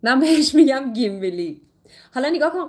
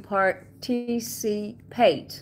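slow participate, each syllable drawn out; much slower than usual. Shown as a demonstration. Teacher typing the word for students, slowly syllable by syllable. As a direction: sound out par-ti-ci-pate.

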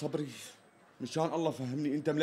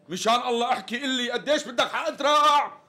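higher, pressing plea for an answer; exasperated; 5 semitones higher, 4 dB louder than usual. Acted. Customer begs the stalling tailor for the price, exasperated. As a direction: beg him to get to the point, fed up. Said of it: "ولك نفخت لي قلبي".

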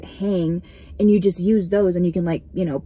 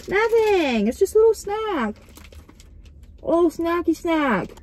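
higher, sing-song snacky voice; playful higher defensive voice; cutesy defence; 7 semitones higher than usual. Pretend. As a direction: talk it up like a cute little treat.

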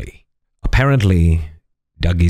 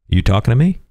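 tough guy quote; 6 semitones higher, faster than usual. Acted. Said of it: "I will now demonstrate pugnacious".

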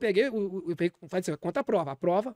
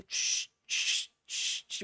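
soft unvoiced tch tch of squeezing a bottle; squirting noise; 6 dB quieter, much slower than usual. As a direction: mimic milking a cow's teat under the breath. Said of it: "ordenhando como se fosse a teta".

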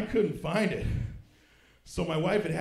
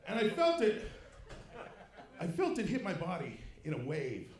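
ordinary talk

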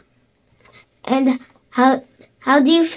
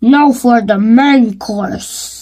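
sly wolf voice; slower than usual. Acted. Wolf gloating before eating.

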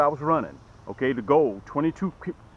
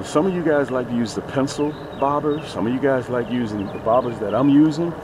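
ordinary delivery

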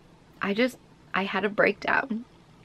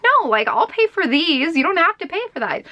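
higher, fast mock protest; 8 semitones higher, 4 dB louder than usual. Arguing with boyfriend over paying, quick and higher. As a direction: rush to refuse his offer. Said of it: "I'm like".

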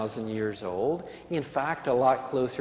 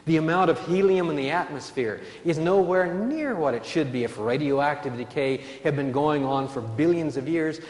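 normal talking voice

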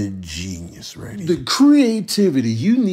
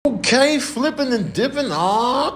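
ordinary talk

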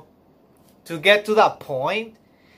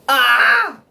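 real tearful cry; 4 semitones higher, 5 dB louder than usual. Real, not acted. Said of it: "I don't want to cry".

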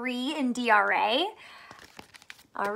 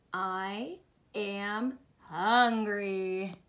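low, very slow, each word separate; slow word-by-word reading; 4 semitones lower, 4 dB quieter, much slower than usual. Acted. Teacher reading the pig's line with beginners, very slowly. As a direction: read it out one word at a time. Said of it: "What is he saying?".

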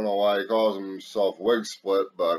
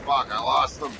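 ordinary talk, nothing marked

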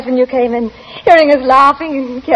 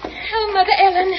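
joyful greeting; 6 semitones higher than usual. Acted. Daisy greets stepmother joyfully.

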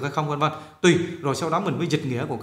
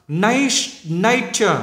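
"nature" with first syllable high and louder; nhấn âm đầu; 4 semitones higher, 4 dB louder, much slower than usual. Shown as a demonstration. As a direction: punch "nat-", let the ending fall. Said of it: "nhá năm nấy này". ("nature" nhấn mạnh âm "nat")

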